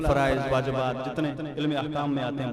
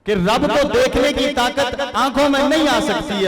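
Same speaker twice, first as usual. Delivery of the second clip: loud, higher-pitched sermon delivery; impassioned preaching; 6 semitones higher, 5 dB louder than usual. Real, not acted. Preacher urging listeners to feel watched by God, impassioned. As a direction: drive the point home to the congregation.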